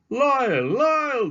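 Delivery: high, loud call across a crowd. Acted. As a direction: call his name over the shoppers. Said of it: "the voice called out".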